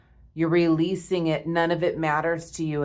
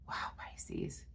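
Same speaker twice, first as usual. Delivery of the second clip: breathless whisper of wonder; awed whisper; 17 dB quieter than usual. Real, not acted. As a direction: whisper it, stunned by what you see. Awed reaction to tarot spread.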